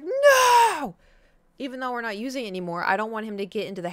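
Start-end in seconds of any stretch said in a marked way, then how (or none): 0.0-1.0 high loud yell at the cat; sharp shout. Retold or imitated. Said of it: "And I was like".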